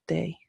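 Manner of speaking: "de" begun like a hard th; hard TH sound. Shown as a demonstration. Teacher explaining the Irish consonant, th-like "de". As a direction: make the d of "de" closer to th. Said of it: "a hard T H".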